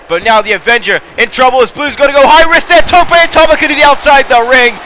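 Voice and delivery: rising commentator hype; hyped call. Real, not acted. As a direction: sell the big spot to the crowd. Big high-risk spot, hyped call.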